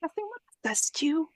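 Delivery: high, whispered rebuke; urgent whisper. Acted. Companion to the speechmaker in the bar, incredulous whisper. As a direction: whisper it in disbelief, voice jumping up.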